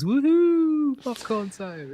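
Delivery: high, whooping advert cheer; excited cheer. Retold or imitated. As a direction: cheer like a cheesy advert crowd. Everyone cheering in mock advert.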